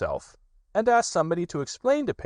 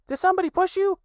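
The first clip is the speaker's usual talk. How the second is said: put-on very high little child's voice; eager small Roo; high excited question; 5 dB louder, faster than usual. Roo asks.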